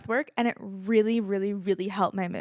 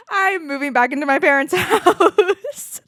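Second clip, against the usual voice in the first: high, loud, drawn-out announcement breaking into laughter; loud, laughing confession; big giddy reveal; 6 semitones higher, 7 dB louder than usual. Real, not acted. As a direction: blurt the news out, pitched up and slow. Big reveal after teasing hints.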